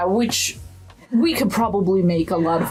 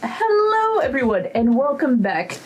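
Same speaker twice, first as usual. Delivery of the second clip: YouTuber voice; 4 semitones higher than usual. Pretend. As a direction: hype up the channel intro. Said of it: "my pretending to be a YouTuber voice".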